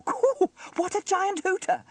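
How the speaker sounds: put-on very high, loud child voice; giggly delight. A narrator's reading. Sarah teases James about his nose, giggly and high. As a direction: squeal it with giggly amusement. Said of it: "she giggled".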